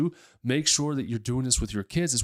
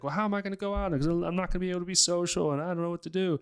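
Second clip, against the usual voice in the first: high, whiny fretting voice, much higher; whiny worried friend; 8 semitones higher, faster than usual. Retold or imitated. Mocking a dieter worried about socializing, whiny high voice. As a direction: pitch it up like a worried, whining dieter. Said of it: "or like".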